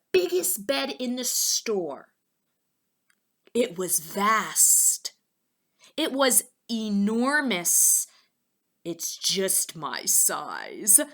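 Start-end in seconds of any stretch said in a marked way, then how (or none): 3.5-8.1 slow and louder, stretching vast and enormous; emphatic drawn-out description. A narrator's reading. Emphasizing bed size. 8.8-11.0 very slow and pleased; slow happy claim; slow satisfied. Acted. Poppleton satisfied.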